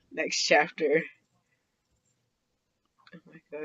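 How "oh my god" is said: breathy whispered exclamation. Real, not acted. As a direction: whisper it, embarrassed. Embarrassed after reading scene.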